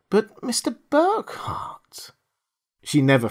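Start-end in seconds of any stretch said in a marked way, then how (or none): 0.1-2.1 breathless whisper, high and slow; whispered plea. Acted. Girl between gun and man, a whispered plea. Girl pleads before gunshot.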